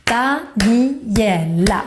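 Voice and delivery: very slow "Daniela" in four separate beats; syllable by syllable. Shown as a demonstration. Teacher counting syllables in her name, "Daniela" slowly split. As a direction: break "Daniela" into Da-ni-e-la. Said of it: "has four syllables".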